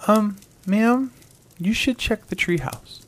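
put-on high, small child's voice; a little boy calling politely. A narrator's reading. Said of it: "she heard a small voice say".

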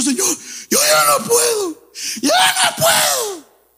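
high, slow, whining plea; whiny complainer. Retold or imitated. Preacher mocking his own whining to God, high wail. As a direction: wail like a believer at the end of his rope. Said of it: "Y dice".

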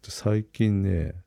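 usual speaking voice

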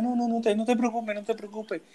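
high, sing-song comforting; mock soothing teacher. Teacher consoling the crying student, high mock soothing. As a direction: coo at him like a soothing teacher.

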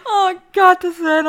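breathless laughing; stifled giggles. Real, not acted. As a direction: giggle through it. Nach Lachanfall. (kichernd, atemlos)

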